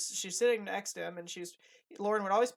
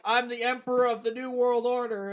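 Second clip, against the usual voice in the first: lightly hammed-up grandiose boast, louder; mock self-importance; 4 semitones higher, 7 dB louder than usual. Host mocking people who take themselves seriously, mock self-importance. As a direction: puff it up to poke fun. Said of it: "don't pretend you're, like, this super cool".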